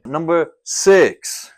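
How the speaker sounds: slow, loud announcer-style reveal; slow, booming countdown call; dramatic countdown. Acted. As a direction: draw out the number like a big reveal.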